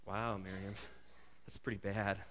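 quiet, laughing smugness; a touch of gloating chuckle; smug first reaction. Retold or imitated. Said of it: "you know what my first thing was".

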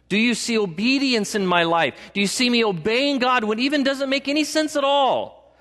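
usual speaking voice

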